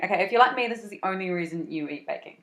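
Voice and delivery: ordinary talk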